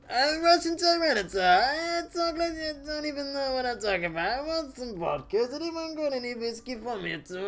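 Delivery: high, mock Russian accent; Russian Tyranitar voice. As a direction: ham up a Russian monster boasting about vodka. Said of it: "you're a Russian Tyranitar now?".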